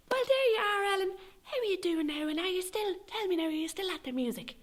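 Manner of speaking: high, chirpy Irish busybody voice; high sing-song gossip voice; nosy neighbour. Retold or imitated. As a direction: play the nosy old neighbour prying sweetly. Retold nosy neighbour Mrs Brady.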